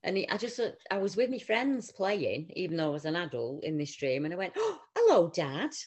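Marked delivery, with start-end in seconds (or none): 4.6-5.7 high, loud joyful hello; delighted greeting. Retold or imitated. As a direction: greet your late dad with joy. Greeting dad in dream.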